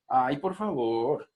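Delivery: slow, stretched mock begging; pleading. Shown as a demonstration. Teacher repeating the example plea, exaggerated pleading. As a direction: drag out "por favor" like a plea. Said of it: "Ruegos, cuando dices".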